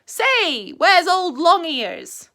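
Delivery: high, loud, slow drawn question; Tigger voice. A narrator's reading. Tigger asking Pooh after Rabbit, loud and high. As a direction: stretch it out brash and bouncy.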